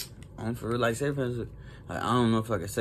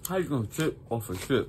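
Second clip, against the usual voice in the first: chewing while talking; eating chips mid-sentence; mouth full. Real, not acted. Seen 3: Pursed, moving lips while holding chip bag, just after eating chips.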